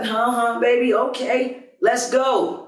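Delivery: put-on groggy girlfriend. Girlfriend woken on the couch.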